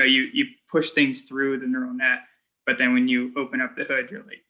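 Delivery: normal talking voice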